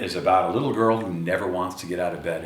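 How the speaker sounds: usual speaking voice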